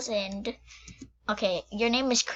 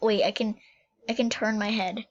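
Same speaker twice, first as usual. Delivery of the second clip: usual speaking voice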